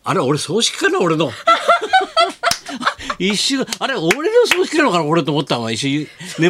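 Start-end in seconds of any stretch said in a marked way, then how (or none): 1.4-3.1 high cackle. Real, not acted. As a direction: burst of high laughter. Laughing at own funeral joke.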